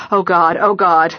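loud frightened gasping words; panicked cry. Acted. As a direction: cry out in shock and fear. Finding Henry rigid on floor.

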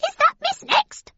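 very high, tiny mouse voice; high-pitched rodent squeal; squeaky mouse. A narrator's reading. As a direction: squeak the question like a curious mouse. Another squeaking mouse chimes in.

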